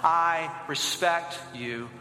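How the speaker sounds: slow, low closing repeat; leading a recitation. Shown as a demonstration. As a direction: draw out each word deliberately. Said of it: "Say that with me".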